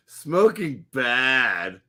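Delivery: slow, blunt caveman speech with a laugh; hammed-up caveman grunting. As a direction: talk like a dumb caveman. Caveman bit about smoking.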